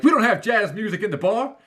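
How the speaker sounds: a hammed-up, high-pitched protest; mock Ricky Bobby. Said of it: "Ricky Bobby is like".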